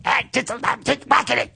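very high, squeaky buddy voice; monkey voice. Acted. Talking to his friend Munchak in their monkey voice. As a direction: chatter like a cartoon monkey. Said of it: "full conversations in this voice".

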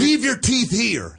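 loud, high, slow Kentucky drawl; broad mocking Southern twang; hammed-up Southern accent. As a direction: call it out like a mock Kentucky welcome sign. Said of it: "everyone sounds southern".

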